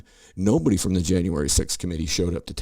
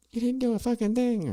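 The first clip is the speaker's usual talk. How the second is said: high mock screech of a ranting host; much higher mock yell; imitating a rant. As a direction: shriek it like a TV host losing it. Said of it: "she was going off on".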